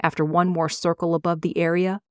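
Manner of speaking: plain reading voice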